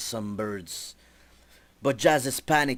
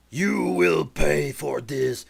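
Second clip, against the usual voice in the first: menacing threat; 6 dB louder, slower than usual. Acted. Enemy threatens the heroes.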